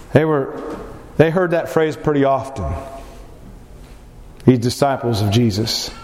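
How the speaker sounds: usual speaking voice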